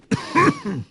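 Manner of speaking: sudden harsh loud cough; coughing fit. Real, not acted. Sorting his inventory, then a loud real coughing fit. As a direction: cough hard, high and loud. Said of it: "All right, done coughing".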